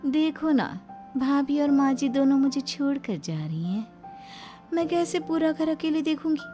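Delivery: high, pouting complaint; plaintive snake-bride. Acted. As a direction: whine sweetly like a wronged young wife.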